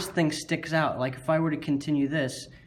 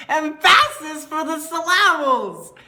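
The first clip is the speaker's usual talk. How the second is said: "Emphasis for the syllables" drawn out slowly, high and loud, stresses warped; mocking the teacher's stress; 13 semitones higher, 8 dB louder, slower than usual. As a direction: parrot the teacher's odd stress back, slow and exaggerated. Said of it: "you said falafel".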